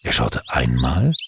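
ordinary delivery, nothing marked

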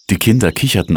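plain reading voice

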